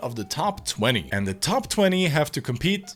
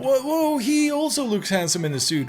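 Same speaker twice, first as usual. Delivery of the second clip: higher-pitched feigned awe; mock admiration; 6 semitones higher than usual. Commentator bored of modeling, mock admiration. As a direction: play at being wowed.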